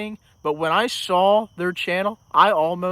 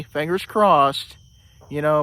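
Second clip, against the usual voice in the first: ordinary delivery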